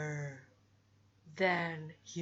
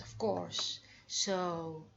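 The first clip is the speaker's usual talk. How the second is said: ordinary talk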